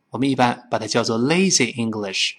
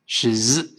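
ordinary talk

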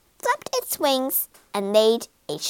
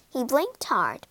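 plain reading voice

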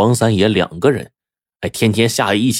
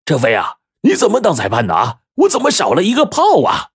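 indignant cry climbing much higher at the end; sudden calling out; 10 semitones higher than usual. A narrator's reading. Old chess player accuses his referee, suddenly crying out. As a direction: burst out in a high-pitched complaint. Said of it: "黄三爷突然叫道".

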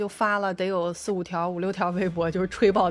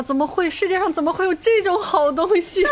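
excited; 9 semitones higher, 5 dB louder than usual. Real, not acted. Gushing over the movie.

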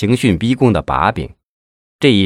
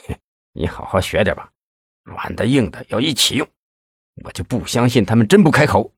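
smug; 3 dB quieter than usual. A narrator's reading. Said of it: "魏显波得意地说".

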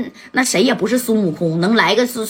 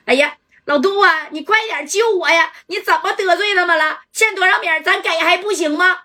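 higher, pleading cry to her husband; frightened cry for help; 7 semitones higher than usual. Acted. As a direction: beg him to save her, voice pitched up in panic. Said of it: "杜家勇的媳妇儿就喊". (惊慌地高声哭喊求救)

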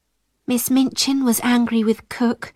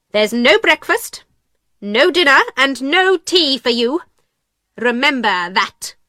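stern pronouncement of punishment; cold; 3 semitones higher than usual. A narrator's reading. Miss Minchin punishing Sarah, cold and stern. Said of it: "Miss Minchin said coldly".

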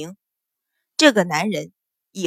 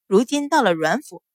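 usual speaking voice

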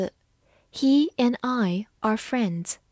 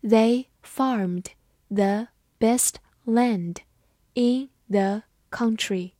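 very slow, word by word; careful slow-motion teacher reading; slow read-through; slower than usual. Shown as a demonstration. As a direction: read it slowly with a pause between words. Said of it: "好，慢读一遍".

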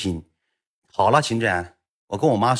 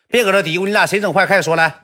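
higher, louder teacher snapping at pupils; teacher ordering pupils to confess, stern and raised; scolding teacher; 9 semitones higher, 4 dB louder than usual. Retold or imitated. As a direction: cut off the muttering like a stern teacher.

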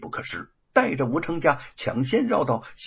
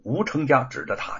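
ordinary delivery

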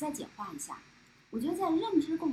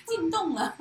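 laughing; 5 semitones higher than usual. Real, not acted. Listener joke, laughing.

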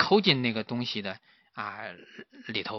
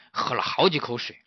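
ordinary delivery, nothing marked

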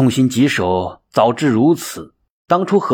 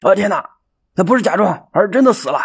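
higher, shocked exclamation; startled cry; 7 semitones higher, faster than usual. A narrator's reading. Bystander discovering the man is really dead, shocked cry. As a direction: gasp it out in horror. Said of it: "惊叫道".

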